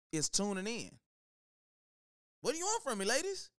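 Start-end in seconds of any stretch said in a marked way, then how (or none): 2.4-3.5 mock exasperation. Mock pleading to female listeners.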